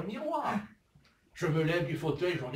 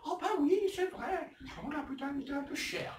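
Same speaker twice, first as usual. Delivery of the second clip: high-pitched agreement; 9 semitones higher than usual. Acted. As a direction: agree in a comically high, gossipy voice. Acted exchange about an expensive bag.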